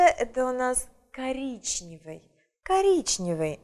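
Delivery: usual speaking voice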